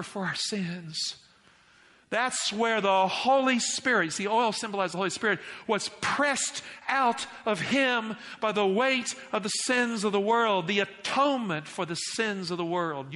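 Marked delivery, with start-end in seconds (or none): 2.1-13.1 raised, emphatic pulpit voice; high, forceful sermon climax; impassioned preaching. Real, not acted. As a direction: press each weighty phrase on the congregation.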